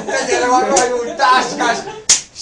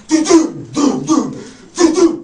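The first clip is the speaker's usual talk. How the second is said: rocket noise imitation; 4 semitones higher, slower than usual. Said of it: "like August twentieth fireworks".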